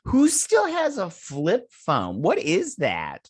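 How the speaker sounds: drawn-out, high, incredulous mockery; slow and high, like you can't believe it; mock disbelief. Real, not acted. Mocking an old phone.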